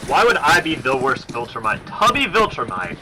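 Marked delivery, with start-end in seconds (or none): none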